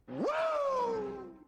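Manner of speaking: squeaky falsetto woo, very high and soft; a falsetto whoop of mock hope; tiny high-pitched celebratory woo; mock cheer. Mocking the hopium message.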